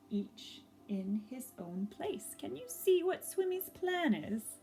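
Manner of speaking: ordinary delivery, nothing marked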